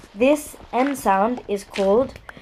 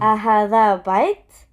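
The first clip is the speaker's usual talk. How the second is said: أهذا بيت said with a rising question tune; 3 dB louder than usual. Shown as a demonstration. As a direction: ask أهذا بيت with the voice going up. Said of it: "you can hear by my tone".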